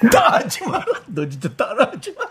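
laughing. Real, not acted. Host laughs repeating caller.

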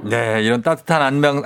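ordinary talk, nothing marked

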